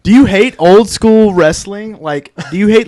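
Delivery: slow, loud pitchman pitch; infomercial voice. Pretend. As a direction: sell it like a late-night TV ad. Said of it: "You sound like an infomercial".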